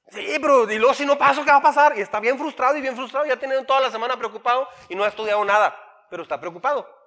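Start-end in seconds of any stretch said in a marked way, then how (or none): 0.1-2.0 higher, louder worried question; anxious teen. Retold or imitated. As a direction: panic a little about failing.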